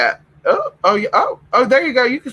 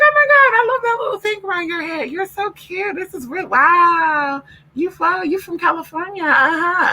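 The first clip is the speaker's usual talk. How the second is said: much higher, gushing flamboyant voice; gushing guy; 12 semitones higher than usual. Retold or imitated. Guy at the airport fawning over her scarf, high and gushing. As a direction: squeal it like an excited admirer. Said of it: "He's like".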